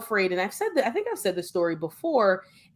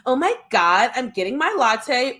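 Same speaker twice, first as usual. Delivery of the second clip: mock California Valley girl voice, a bit higher; lightly imitating a Valley girl ordering a latte; 4 semitones higher, 3 dB louder than usual. Mocking the foreign view of Americans, light Valley girl voice. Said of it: "or it's a California Valley girl".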